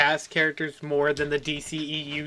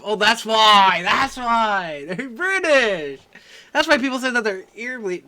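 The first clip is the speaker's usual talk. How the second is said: mock joke voice; 4 semitones higher, 4 dB louder, slower than usual. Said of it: "I had to make the joke of".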